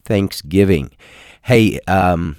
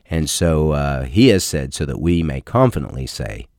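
ordinary delivery

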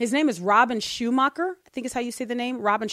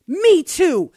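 higher and louder, keen questioning; second man voiced eager and loud; eager stranger; 6 semitones higher, 8 dB louder than usual. A narrator's reading. Voicing second man, laughing.

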